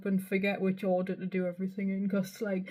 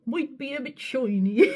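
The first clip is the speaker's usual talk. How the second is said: higher, hammed-up Bristolian, "shoiny"; mock Bristolian accent; 5 semitones higher, 4 dB louder than usual. Vlogger mimicking her own accent slip, mock Bristolian. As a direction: exaggerate a bad Bristol accent. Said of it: "there I went a bit Bristolian".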